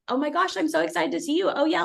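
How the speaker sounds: high-pitched imitated gushing; excited practice member. Patient welcoming the chiropractor back from vacation, excited. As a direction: play a patient thrilled at the reunion. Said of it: "your practice members are excited for you".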